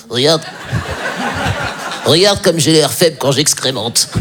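slow, feeble voice of a humiliated dog; pitiful dog. Acted. Squatting hunting dog shamed before its owner, slow pitiful voice. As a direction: drag it out, weak and pathetic.

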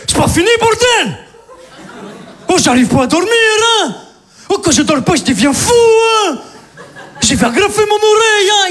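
crazy neighbor. Retold or imitated. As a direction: rant like an unhinged madman. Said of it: "parler comme ça".

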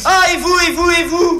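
very high, loud comic squabble; quarrelling retort. Acted. Accused partner throwing it back, high quarrelling shout.

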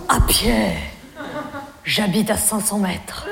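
low, slow man's reply; Jean-Loup. Retold or imitated. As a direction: answer low and slow, as the suitor. Voicing Jean-Loup's reply. (voix grave et lente de prétendant)

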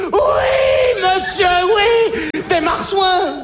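high, loud, drawn-out yes sir; insistent shout. Acted. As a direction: shout it to be believed. Insists loudly on alien story.

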